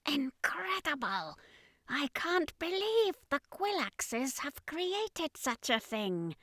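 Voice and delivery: high, slow whispered awe; awed alien commander. Acted. Commander seeing the flying city, high whispered awe. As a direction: breathe it out in high alien wonder. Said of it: "the commander said".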